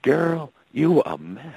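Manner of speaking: sassy Southern twang, mock backstage gossip; lightly done Southern drawl to a girlfriend; Southern country accent. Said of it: "she goes to her girlfriend".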